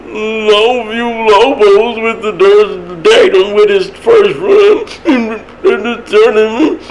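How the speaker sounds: high, slow, quavering mock announcer on the verge of tears; imitating tearful announcer. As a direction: mimic an old announcer about to cry, stretched out and high. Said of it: "sounded like he was about to cry every time he talked".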